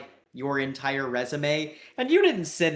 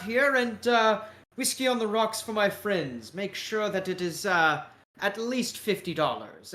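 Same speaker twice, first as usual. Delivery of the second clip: higher-pitched, courteous British caricature; British accent; 5 semitones higher than usual. Acted. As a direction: order grandly in a British accent. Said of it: "with a British accent".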